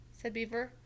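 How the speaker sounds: usual speaking voice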